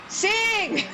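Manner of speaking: high, drawn-out audience call; crowd demanding. Acted. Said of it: "the crowd is just like".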